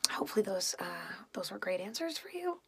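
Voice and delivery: hushed, whispered sign-off remark; soft, confidential whisper; whispered aside. Real, not acted. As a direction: drop right down to a near-silent whisper.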